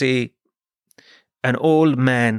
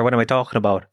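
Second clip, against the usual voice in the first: normal talking voice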